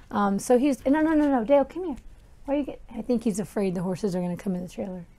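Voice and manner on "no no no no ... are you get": calling the dog. Real, not acted. Calling the dog away from horses.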